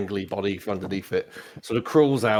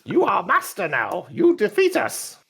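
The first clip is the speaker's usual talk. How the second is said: high, slow droid voice; pit droid; 10 semitones higher, 4 dB louder, slower than usual. Acted. Droid bows to the one who defeated it, high slow voice. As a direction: declare it slowly as a high-pitched droid. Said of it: "best describe as a pit droid".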